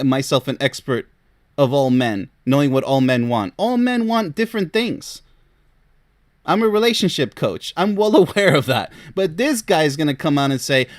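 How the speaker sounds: ordinary talk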